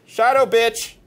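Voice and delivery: loud, high bravado; hollered threat; taunting shout. Real, not acted. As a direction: challenge the spirit to a fight. Taunting the Shadow Man.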